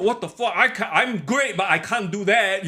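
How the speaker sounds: high, stunned outburst; shocked reaction. Retold or imitated. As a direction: react like you can't believe it. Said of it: "let the Will Ospreay go".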